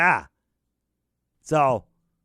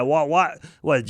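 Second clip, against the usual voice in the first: ordinary talk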